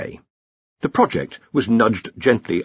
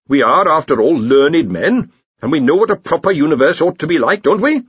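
higher, louder donnish appeal; persuading senior wrangler; 7 semitones higher, 6 dB louder than usual. Acted. Senior wrangler urges colleagues to intervene, donnish and persuasive. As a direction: appeal to their learning, lifting to a question.

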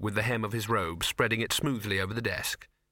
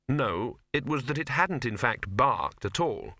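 plain reading voice

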